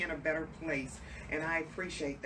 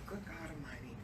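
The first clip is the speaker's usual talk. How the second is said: hushed inner exclamation; 10 dB quieter than usual. Retold or imitated. Host reacting to the guest's childhood story, hushed exclamation. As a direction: breathe it out quietly, stunned. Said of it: "I thought to myself".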